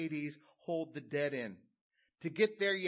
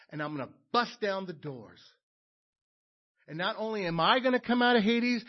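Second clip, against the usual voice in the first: loud, ringing sermon voice, louder and higher; preaching at full force; 6 semitones higher, 11 dB louder than usual. Acted. Preacher proclaiming Jesus' victory over death to his congregation, full-force preaching. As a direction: preach it out to the whole church, triumphant.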